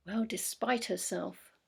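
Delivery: usual speaking voice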